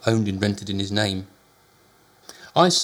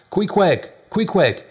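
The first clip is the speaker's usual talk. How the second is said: loud, high alarmed calls; urgent raised shouts; shouting his name; 9 semitones higher, 6 dB louder, much slower than usual. A narrator's reading. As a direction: yell his name through the door. Shouting name at locked door.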